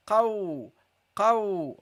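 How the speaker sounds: teacher models qaw to repeat; Arabic qaf. Shown as a demonstration. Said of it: "Repeat after me".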